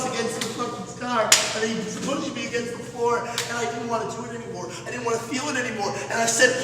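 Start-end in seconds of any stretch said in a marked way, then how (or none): none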